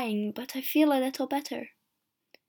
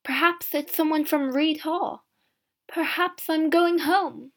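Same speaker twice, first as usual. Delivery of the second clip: ordinary delivery, nothing marked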